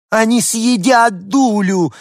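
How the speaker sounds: aunt wailing in fright. Acted. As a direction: wail it from afar in panic. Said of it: "причитала тётя".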